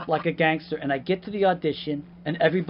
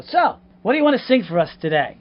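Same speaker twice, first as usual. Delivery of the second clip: loud, higher-pitched casting-room welcome; hammed-up eager greeting; 7 semitones higher, 10 dB louder than usual. Casting director to nervous actor, hammed-up eager. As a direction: play the over-eager auditioner's host.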